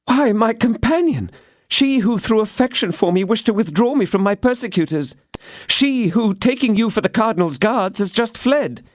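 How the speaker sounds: put-on high young woman's voice, earnest; Constance, innocent. A narrator's reading. Constance explains to D'Artagnan, high and innocent.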